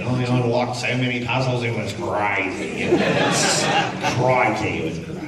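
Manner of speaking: put-on a light Australian accent, tossed off; a passing Aussie "Crikey" in a hint of Australian. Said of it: "I didn't talk like that in front of them".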